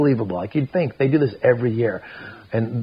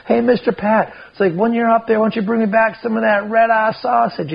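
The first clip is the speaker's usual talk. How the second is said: high-pitched playful holler, acted out; retold calling out; 11 semitones higher, 3 dB louder than usual. Skipper to Mister Pat heading to the shitter, retold high call. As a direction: imitate a joker calling after a friend.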